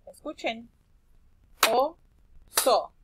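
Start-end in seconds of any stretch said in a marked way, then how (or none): 1.7-2.9 "Oso" split loud into O-SO; clapped syllables. Shown as a demonstration. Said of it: "dar una palmada cuando escuchemos cada sílaba". ("Oso" separado en sílabas con palmadas)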